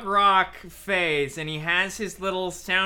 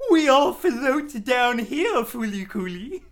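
Pennywise voice; 6 semitones higher, 5 dB louder, slower than usual. Pretend.

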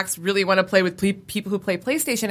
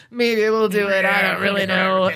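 grumbling. Retold or imitated. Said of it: "kinda grumbling about it".

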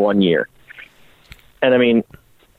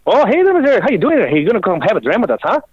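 high, mock Scottish chatter; hammed-up Scottish brogue; Scottish accent; 9 semitones higher than usual. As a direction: imitate jovial Scotsmen in a raised pitch. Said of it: "I meet these Scottish guys".

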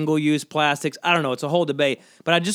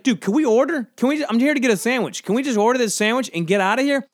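higher, exasperated plea to hurry; stuck behind chatty customers, exasperated and higher; impatient customer; 6 semitones higher than usual. Retold or imitated. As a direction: sound fed up waiting in line. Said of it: "and I'm just like".

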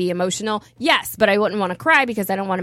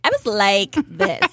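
mock quote; 3 semitones lower, slower than usual.

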